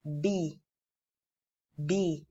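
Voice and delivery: "B" said as a short bi, mouth nearly closed; demonstrating ba with kasra. Shown as a demonstration. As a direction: say "B" with the mouth dropping. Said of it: "my mouth goes down".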